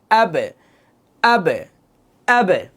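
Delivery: আবে with the ব bounced into an echo; echoed ending. Shown as a demonstration. Teacher drilling the echo for the class. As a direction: repeat আবে, letting the ব rebound. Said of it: "প্রতিধ্বনি একটু দেখেন".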